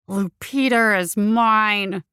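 slow, loud menacing hiss. Acted. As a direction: hiss it out like a phantom. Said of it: "he hissed".

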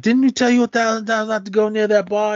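very high-pitched nagging mother; scolding mom. Retold or imitated. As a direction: imitate a mother telling off her kid. Mom scolding her son, retold.

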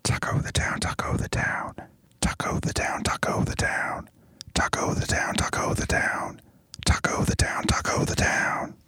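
soft breathy whispered chant; whispered jingle. Acted. Host whispers the segment jingle into the break. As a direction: whisper the title over and over.

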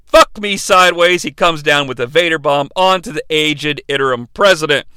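ordinary talk

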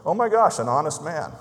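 playful imitated surprise; mock amazement. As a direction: gasp it out as if honesty is a shock. Customer's amazed reply acted out.

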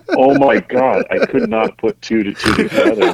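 high, laughing mock dismay; hammed-up disbelief breaking into laughter; laughing realization. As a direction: exclaim it through embarrassed laughter. Retells embarrassed realization, laughing.

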